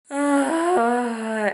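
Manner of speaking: loud, long groan of dread; big drawn-out "ugh"; exasperated groan. Real, not acted. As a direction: groan out loud at having to go back. Dreads going back for filling.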